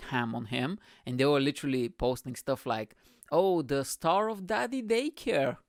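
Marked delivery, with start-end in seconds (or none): 3.3-5.6 slow, higher-pitched taunt; mock scandalized headline. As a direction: draw out the star's billing mockingly. Voices mocking media posts.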